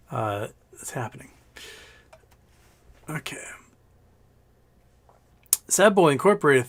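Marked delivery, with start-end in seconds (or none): 0.1-3.4 whisper. Real, not acted. Aside while reading chat.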